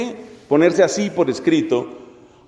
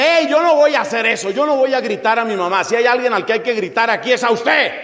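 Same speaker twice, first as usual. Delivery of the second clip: higher-pitched mock protest; acted maternal outrage, pitched up; indignant mother; 4 semitones higher than usual. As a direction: object like an affronted mum. Voices an objecting mother.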